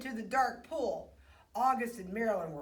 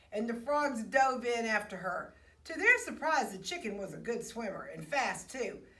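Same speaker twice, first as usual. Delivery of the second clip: usual speaking voice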